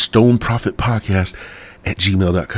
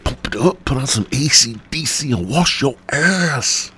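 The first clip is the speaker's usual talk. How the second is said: much higher hyped rally cry; hype man; 7 semitones higher than usual. Acted. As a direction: pump up the crowd like a hype man. Hype coach shouting.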